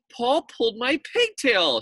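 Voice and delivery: high, loud tattletale whine; little girl's indignant complaint; tattling girl. Acted. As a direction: tell on him to the teacher. Leslie tattling to teacher.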